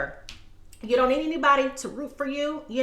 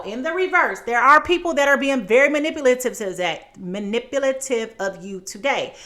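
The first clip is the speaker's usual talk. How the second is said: ordinary talk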